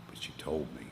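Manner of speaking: breathy hush, barely audible; whisper. Real, not acted. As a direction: drop into a whisper. Voice drops to whisper.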